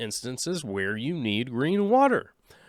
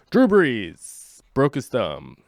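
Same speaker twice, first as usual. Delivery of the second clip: slow deadpan; 5 dB louder, much slower than usual. Pretend. Deadpan answer, acted quote.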